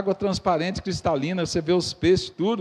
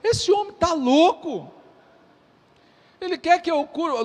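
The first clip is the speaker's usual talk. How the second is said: the king's alarmed outburst; 7 semitones higher than usual. Retold or imitated. Preacher voicing the king of Israel, alarmed and higher. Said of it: "Ele falou assim".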